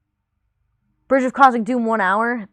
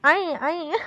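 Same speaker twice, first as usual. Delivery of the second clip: higher wail in dumb blonde voice; mock wail, pitched up; 6 semitones higher than usual. A narrator's reading. Legolas crying out at the Balrog, mock wail. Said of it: "wailed Legolas".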